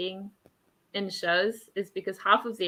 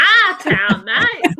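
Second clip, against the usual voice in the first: our town, mate, in a broad Australian accent, louder and higher with a laugh; 6 semitones higher, 9 dB louder, much slower than usual. Shown as a demonstration. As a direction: ham up the Aussie accent, laughing. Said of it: "With my weird Australian accent?".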